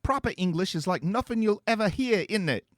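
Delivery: put-on high, loud, lazy British accent; teen teasing in a half-hearted British accent; fake British accent. A narrator's reading. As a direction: barely try at the British accent. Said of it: "now you're not even trying".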